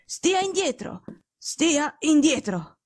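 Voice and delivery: ordine secco, alto e lento; secco e imperioso. Acted. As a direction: respingere l'amico con autorità. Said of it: "tono secco e imperioso". (Holmes ordina a Watson di stargli lontano, secco e imperioso)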